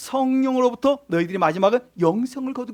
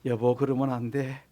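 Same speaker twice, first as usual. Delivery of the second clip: much lower and softer, a mild scolding; gentle soft rebuke; 8 semitones lower, 8 dB quieter than usual. Shown as a demonstration. Preacher shows how to get angry at a spouse, soft and gentle. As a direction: scold your spouse kindly, barely above a murmur. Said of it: "어떻게 화를 내야 되겠습니까?".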